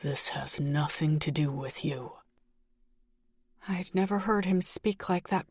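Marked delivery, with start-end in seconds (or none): 0.0-2.2 lower, softer, ice-cold menace; chilling cold tone. A narrator's reading. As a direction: threaten her quietly like a killer. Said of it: "in a tone that cooled my blood".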